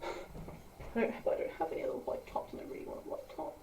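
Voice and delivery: soft, fast whisper with a sigh; whispered complaint. Real, not acted. Complaining to the camera about her see-through top. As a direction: grumble it under the breath.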